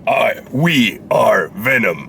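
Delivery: slow, drawn-out monster voice; Venom voice. Pretend. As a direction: drag out the catchphrase like a monster. Mocking Venom's catchphrase.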